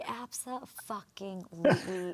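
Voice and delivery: slow, drawn-out, hushed refusal; emphatic imagined retort. Retold or imitated. Host voicing what she wanted to tell the guest, slow refusal. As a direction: stretch it out syllable by syllable, softly. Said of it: "I wanted to be like".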